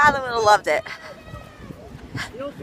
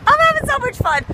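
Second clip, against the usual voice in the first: excited; 13 semitones higher, 3 dB louder than usual. Real, not acted. Excited vlogger heading into blizzard.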